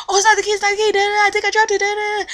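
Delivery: high, quick flustered voice; flustered coworker. Retold or imitated. Coworker who lost the keys, high and flustered. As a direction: play the ditzy panicked coworker.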